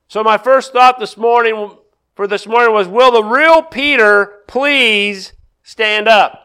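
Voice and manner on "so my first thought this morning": ordinary talk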